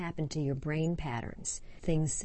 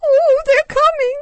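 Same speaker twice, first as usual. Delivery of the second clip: put-on very high, loud sobbing little-girl cry; crying child; 18 dB louder than usual. Frightened child in acted story.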